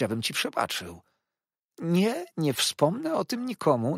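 forgive him with poisonous sweetness; oily humble clerk. A narrator's reading. Narrator voices Uriah.

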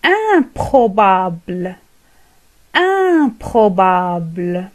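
improbable said very slowly; very slow model of improbable; slow model word. Shown as a demonstration. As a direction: draw out improbable. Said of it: "تعطينا ضدها".

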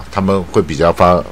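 usual speaking voice

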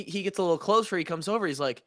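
ordinary talk

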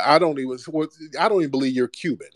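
ordinary delivery

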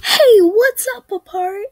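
mock hyped vlogger greeting; YouTuber greeting. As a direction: shout it like an excited channel opener. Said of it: "Just saying".

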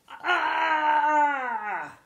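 long, high dinosaur scream; loud drawn-out shriek of alarm; hammed-up terrified "aah"; loud cry of fright. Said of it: "cried the Gigantosaurus".

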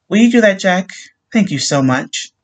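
grateful widow. A narrator's reading. Widow thanks sergeant sweetly.

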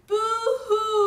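high, loud drawn-out wail; crying baby. A narrator's reading. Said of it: "The baby said".

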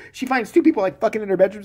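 ordinary delivery

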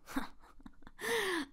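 high, scornful laugh; mocking laugh. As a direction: laugh down at him. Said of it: "er hörte ihn lachen".

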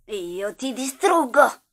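slow, loud and menacing; loud slow threat. Acted. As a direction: spit out the threat, drawn out. Minaccia lo specchio. (minaccia urlata e lenta)

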